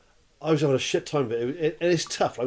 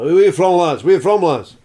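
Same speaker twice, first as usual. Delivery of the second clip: loud, higher-pitched Scottish barfly; Scottish accent; 4 semitones higher, 10 dB louder than usual. Retold or imitated. Old Scot at the bar hailing visiting lads, loud Scottish accent. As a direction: call it out as an old Scottish drinker. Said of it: "this old Scottish guy at the bar".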